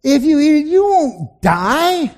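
high, slow, sly serpent voice; drawn-out hissing tempter; tempting serpent. Acted. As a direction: coax her slowly like the snake in the garden.

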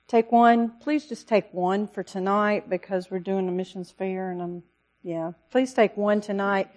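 usual speaking voice

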